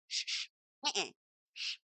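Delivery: soft playful shushing. Real, not acted. Hushing herself mid-rant, soft shushing. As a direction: hush yourself, shh. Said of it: "I'm being quiet".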